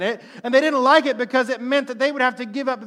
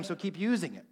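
soft take; 3 semitones lower, 9 dB quieter than usual. Shown as a demonstration.

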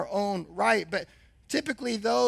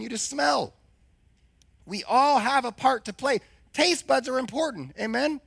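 preaching; 4 semitones higher, 3 dB louder than usual. Real, not acted. Preaching with rising fervor.

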